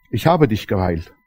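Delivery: low, soft voice of Jesus; Jesus speaks gently at the bedside. Retold or imitated. Jesus quoted in the story.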